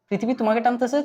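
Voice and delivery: normal talking voice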